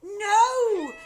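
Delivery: very high loud no; crying out. A narrator's reading. Family crying out to stop grandma. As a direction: cry it out in alarm. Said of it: "the other two cried".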